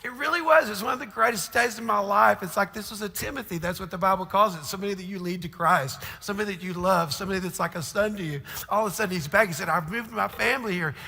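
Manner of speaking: high, loud, overflowing with emotion; impassioned preaching. Acted. Pastor telling his church about a friend's return, impassioned. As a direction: preach it at full force, heart bursting. Emotional retelling, full force.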